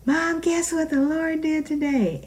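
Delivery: put-on high, eager child's call to Mom; daughter calling. Daughter calling her mom with good news, eager. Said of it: "she would call me".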